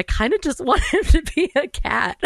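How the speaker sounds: high, laughing through the words. Real, not acted. Podcaster recalling cat meows in the edit, laughing. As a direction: let real laughter break into the line. Laughing while admitting a wish.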